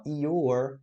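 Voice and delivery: "your" repeated, stretched, all three sounds; teacher repeating "your" for practice; slow drill. Shown as a demonstration. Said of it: "quando a gente fala assim".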